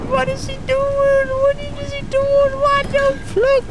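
shrill high-pitched panic; high panicked squeal. Real, not acted. Panic in game, monster chasing.

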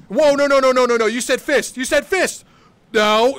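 rapid-fire high, loud shouting; panicked protest. Real, not acted. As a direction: object frantically as you come under attack. Panicked protest as opponent breaks rule.

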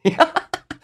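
high burst of wild laughter; high, crazed cackling laugh. A narrator's reading. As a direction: laugh like a madman. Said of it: "洪德利的大笑声".

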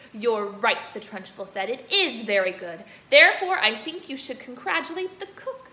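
loud, sarcastic headmistress; mock him before the school. Headmistress loudly mocking Bruce.

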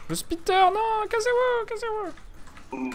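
high panicked yelling; panicking gamer. Real, not acted. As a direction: shriek at them to get away. Spitter attack, yelling at teammates. (joueur paniqué qui crie)

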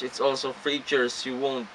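normal talking voice

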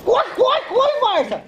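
high, loud, laughing shouts; being chased. Real, not acted. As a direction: yell urgently while running after it. Chasing the falling kite. (gritando na correria)

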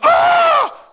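very high, very loud "Ah!"; belted shout. Retold or imitated. Preacher roaring at the congregation, huge high shout. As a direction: belt it like a fiery preacher. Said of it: "he belted out something really loud".

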